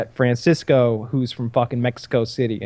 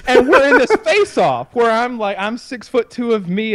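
laughing; 9 semitones higher, 4 dB louder than usual. Real, not acted. Laughing while telling.

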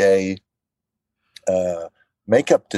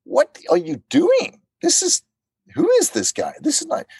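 incredulous protest. Retold or imitated. Actor protesting to the game makers, high and incredulous. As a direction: shoot way up in pitch, baffled and protesting. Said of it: "And I'm going".

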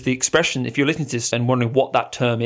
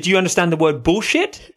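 higher, louder pointed question; indignant confrontation; confronting; 6 semitones higher, 4 dB louder than usual. Retold or imitated. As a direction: challenge her, slightly mocking. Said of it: "I said".

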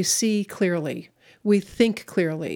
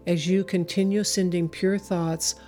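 guided meditation. Acted. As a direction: guide them gently, long pauses. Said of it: "take you through a meditation".

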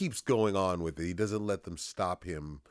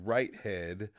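normal talking voice

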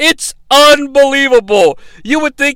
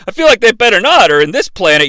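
ordinary talk

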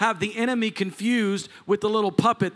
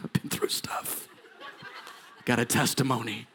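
hushed, low voice of a worn-out puppet; battered puppet; 9 semitones lower, 7 dB quieter than usual. Acted. Old church puppet confiding what it has been through, hushed. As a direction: murmur it low, as a puppet who has suffered. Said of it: "he's like".

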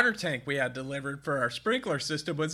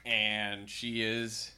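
ordinary delivery

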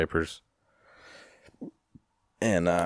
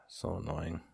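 much softer, under the breath; quiet grumble; 14 dB quieter than usual. Real, not acted. Grumbling to self about the undrinkable keg, very quiet. Grumbles to himself, then repeats it.

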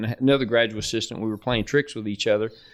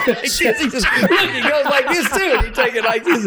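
high, laughing through the words; cracking up as he acts out the projector flip; laughing while demonstrating; 13 semitones higher, 4 dB louder than usual. Real, not acted. To the guests, acting out the coach's projector flip while laughing.

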